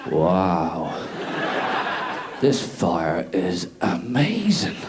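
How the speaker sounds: slow stoned voice. Retold or imitated. Stoned man staring at fire.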